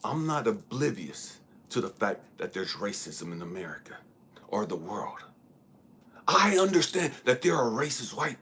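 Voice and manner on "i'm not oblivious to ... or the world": whisper. Real, not acted. Sudden hushed aside.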